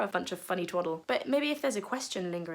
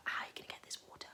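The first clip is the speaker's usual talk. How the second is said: whispered doubtful question; 15 dB quieter, faster than usual. Acted. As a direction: hush it like a secret aside. Woman's doubtful aside.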